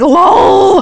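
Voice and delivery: high, loud, rising 'lol'; mock-excited high whoop of a word; exaggerated texted reaction. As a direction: swoop up loudly, hamming up a text. Acting out mom's texted reply.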